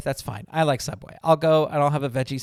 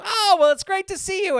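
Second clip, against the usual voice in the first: much higher, beaming voice of the shop owner; imitated cheerful owner; 5 dB louder than usual. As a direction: gush like a happy shopkeeper. Owner greets him, retold.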